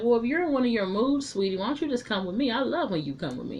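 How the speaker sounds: sweet coaxing wife. Retold or imitated. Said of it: "And she was like".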